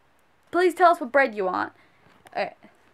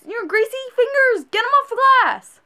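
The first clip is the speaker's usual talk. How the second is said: exasperated; 9 semitones higher, 5 dB louder than usual. Real, not acted. Scolding customers touching glass.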